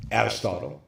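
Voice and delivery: usual speaking voice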